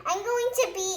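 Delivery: high, loud hysterical wailing; panic. A narrator's reading. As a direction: wail about being eaten alive. Mister Jelly fears the snake.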